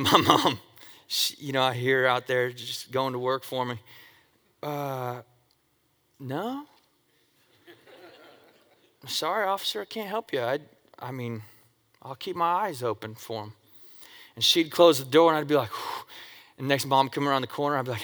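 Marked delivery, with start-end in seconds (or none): 4.6-13.5 much softer, innocent mom covering; hushed mom. Retold or imitated. Mom covering for her son with the officer, much softer. 15.7-16.0 very soft relieved exhale; relieved whew. Retold or imitated. The kid after the cops leave, soft relieved whew.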